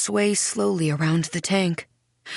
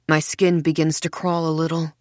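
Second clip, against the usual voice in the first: ordinary delivery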